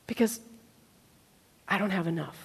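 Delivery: soft, low and halting; small, hushed voice of doubt; quiet admission. Acted. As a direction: confess it quietly, dropping low. Acted confession of lack.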